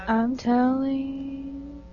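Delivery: slow, drawn-out mock tattling; tattletale singsong. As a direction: stretch it out like a teasing kid. Playful tattling like a child.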